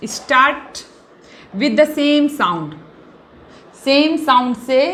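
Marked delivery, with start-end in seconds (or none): 0.1-2.7 slow, word-by-word dictation with pauses. Shown as a demonstration. Teacher to class, dictating the definition slowly word by word. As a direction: spell out a definition for students to copy.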